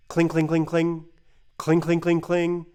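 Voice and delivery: rhythmic clinking noise, spoken; dice clinking imitation. Recalling dice heard from the station, clinking rhythmically. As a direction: mimic dice rattling in a bowl.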